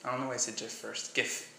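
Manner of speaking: ordinary talk, nothing marked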